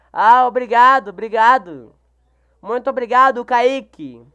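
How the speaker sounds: loud happy thanks for a compliment; excited thanks. Real, not acted. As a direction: burst out with thanks to the viewer.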